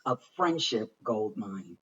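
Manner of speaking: usual speaking voice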